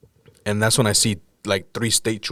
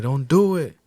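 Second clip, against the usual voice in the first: raised, higher re-enacted plea; retold pleading yell; 6 semitones higher, 4 dB louder than usual. Urging friend back from jumping, retold yell. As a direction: imitate yelling out a warning. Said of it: "So I just start yelling at him".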